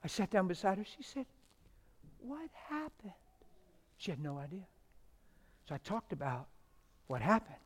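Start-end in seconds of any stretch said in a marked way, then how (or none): 2.2-3.1 soft, high, frail patient voice; tiny bewildered voice, much higher and softer; imitated weak whisper. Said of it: "She's whispering, trach tube".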